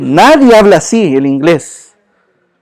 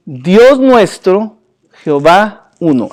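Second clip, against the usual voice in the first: very slow, deliberate scripture reading; slow solemn Shema; 2 semitones lower, slower than usual. Shown as a demonstration. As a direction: read each word out slowly and weightily. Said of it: "reading the Shema aloud".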